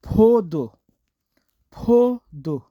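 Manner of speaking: फोदो said slowly with a clear O; careful demo of फोदो; Korean word for grape. Shown as a demonstration. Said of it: "ये फोदो पढ़ेंगे इस तरह".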